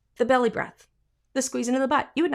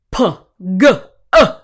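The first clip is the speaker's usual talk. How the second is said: short firm glottal g clicks; glottal g sounds; 3 semitones lower, 10 dB louder than usual. Shown as a demonstration. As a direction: pop a hard g on the cords. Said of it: "You can feel the muscles going".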